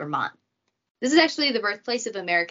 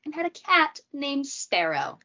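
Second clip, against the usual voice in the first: ordinary talk, nothing marked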